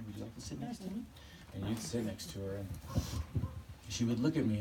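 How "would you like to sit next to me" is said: soft, much quieter voice for Natasha; softly imitating her asking you to sit; gentle invitation. Imitating Natasha inviting you onto the couch, soft and quiet.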